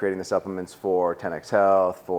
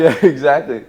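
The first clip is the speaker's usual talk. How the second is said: laughing; 6 semitones higher, 10 dB louder than usual. Real, not acted. Laughing at host's joke.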